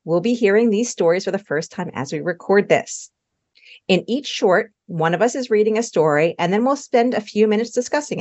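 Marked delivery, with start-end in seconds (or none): none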